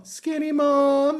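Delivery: very high, slow sing-song; sing-song prompt. Retold or imitated. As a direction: coax it sweetly and slowly. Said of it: "mother baiting compliment".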